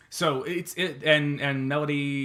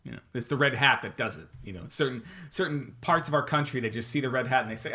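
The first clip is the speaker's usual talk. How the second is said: normal talking voice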